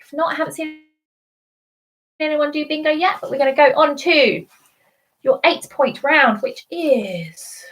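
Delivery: normal talking voice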